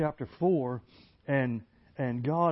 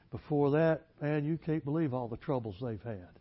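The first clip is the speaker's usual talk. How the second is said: ordinary talk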